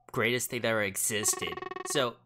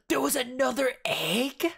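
shocked; 9 semitones higher, 3 dB louder, slower than usual. Acted. Shocked at game event.

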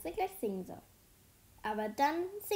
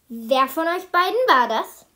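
loud, raised-pitch threatening demand; dangerous, menacing; 5 semitones higher, 15 dB louder than usual. A narrator's reading. Teacher cornering two pupils, loud and dangerous. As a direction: confront the two culprits loudly. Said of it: "sagt sie gefährlich".

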